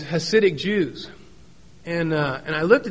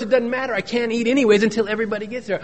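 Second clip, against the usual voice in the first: ordinary delivery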